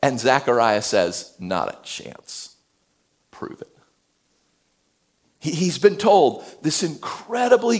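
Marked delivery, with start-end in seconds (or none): 1.4-3.7 low, dismissive scoff; scoffing retort. Retold or imitated. Zechariah quietly scoffing at the angel's promise.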